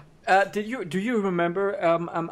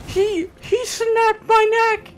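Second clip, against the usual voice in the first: shocked; 4 dB louder, slower than usual. Real, not acted. Shocked at sudden death.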